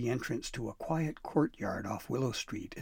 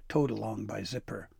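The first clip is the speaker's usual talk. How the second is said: ordinary delivery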